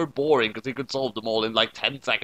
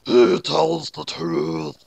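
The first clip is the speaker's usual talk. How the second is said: very slow, louder ghostly voice; slow spooky roar; 5 dB louder, much slower than usual. Acted. Shinigami vouching for Soichiro, very slow and louder. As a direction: intone it like an unseen death god. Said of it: "Blood roared".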